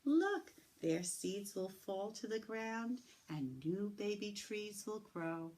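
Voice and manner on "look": ordinary delivery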